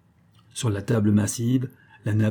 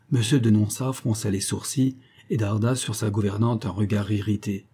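ordinary delivery

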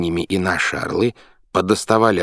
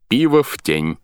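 higher-pitched captain's order; commanding; 6 semitones higher, 3 dB louder than usual. A narrator's reading. Captain instructs crew about beer, commanding. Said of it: "приказал капитан".

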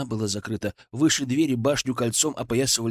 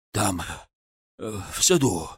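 out of breath; 4 semitones lower than usual. A narrator's reading. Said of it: "задыхаясь".

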